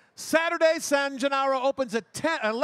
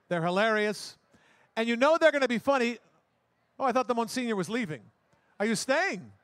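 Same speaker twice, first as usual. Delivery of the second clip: usual speaking voice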